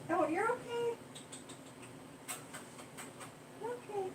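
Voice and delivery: high, soft, soothing pet voice; comforting the cat. Real, not acted. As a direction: coo gently to calm a scared kitty.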